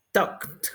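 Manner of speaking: TALKED in one syllable, "tokt". Shown as a demonstration. Teacher modelling the past, -ed as T. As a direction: release a T after the K in TALKED. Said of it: "la E D como una T".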